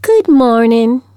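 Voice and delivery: put-on slow, high sweet southern accent greeting; drawn-out honeyed southern accent hello. A narrator's reading. Science teacher greeting Miss Meadows, sweet southern accent. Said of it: "she cried in her sweet southern accent".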